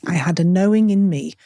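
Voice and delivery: ordinary talk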